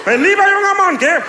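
sehr hoch und laut, drohend; empörter Polizist. Retold or imitated. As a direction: droh mit erhobenem Finger. Wütender Polizist, Hose rum. (bayerischer Polizist empört, sehr hoch und laut)

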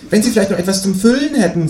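high-pitched, borrowing kangaroo. A narrator's reading. Kangaroo asks neighbour for a whisk, high voice. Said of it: "sagt das Känguru".

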